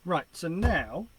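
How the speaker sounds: loud, slow, high blast; booming over the headphones; way too loud. Real, not acted. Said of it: "that's way loud".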